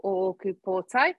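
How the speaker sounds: ordinary talk, nothing marked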